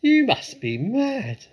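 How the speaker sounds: high, loud, drawn-out jeer; mock them in a slow, high shout. A narrator's reading. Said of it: "The people jeered and laughed".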